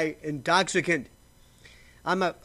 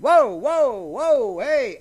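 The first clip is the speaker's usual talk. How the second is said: loud, high startled whoa; imitating a startled audience member; mock alarmed interruption; 6 semitones higher, 9 dB louder, much slower than usual. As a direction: stop the singer cold, hammed up. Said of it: "people would have said".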